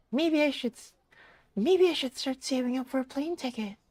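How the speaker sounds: very high put-on voice; young character voice. Young character planning a Seattle trip, very high voice. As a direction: muse in a small, high character voice.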